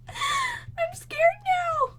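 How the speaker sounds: high, slow, tearful whimper; real fear, whimpering; scared whimper. Real, not acted. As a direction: let fear crack the voice. Alarmed after mistake in game.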